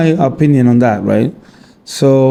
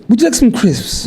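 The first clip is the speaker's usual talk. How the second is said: put-on British accent, higher pitch; 6 semitones higher than usual. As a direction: play a British host offering snacks. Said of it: "That's a British accent".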